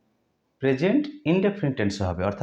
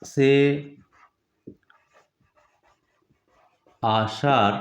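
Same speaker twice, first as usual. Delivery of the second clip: word by word, long pauses between; slow dictation; much slower than usual. Shown as a demonstration. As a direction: dictate it very slowly, pausing after every word. Teacher dictates example word by word.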